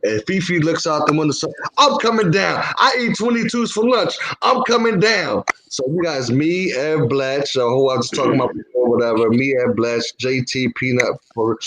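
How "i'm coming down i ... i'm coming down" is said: high, defiant call from above; shouting down. Acted. Tough man answers from window, defiant. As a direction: holler back as the tough guy.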